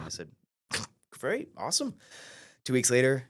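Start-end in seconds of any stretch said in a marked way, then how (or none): 1.1-2.0 higher, eager great awesome; answering Michael with mock enthusiasm; mock eager reply. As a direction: play it overexcited. His eager reply quoted.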